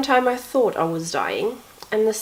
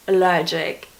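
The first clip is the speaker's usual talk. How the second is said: normal talking voice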